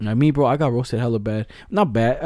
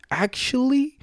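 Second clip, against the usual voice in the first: a much higher, drawn-out know-it-all "Actually"; nerdy know-it-all; 11 semitones higher, slower than usual. Retold or imitated. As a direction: play the smug smart kid correcting. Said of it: "he would be like".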